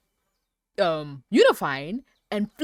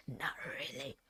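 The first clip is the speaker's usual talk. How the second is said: unvoiced whisper, much softer; whispered aside; 6 semitones lower, 15 dB quieter than usual. Real, not acted. Admitting she doesn't care, whispered aside. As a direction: breathe it out like a confession.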